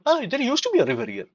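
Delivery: high, mocking sigh of regret; sing-song mock nostalgia; mock wistful quote. Mocking people years later.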